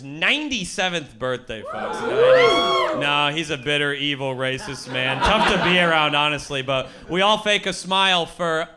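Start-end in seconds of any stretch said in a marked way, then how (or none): none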